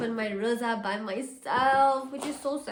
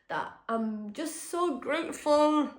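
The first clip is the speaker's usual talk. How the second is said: tearful; 5 semitones higher, slower than usual. Real, not acted. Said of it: "oh, I'm gonna cry".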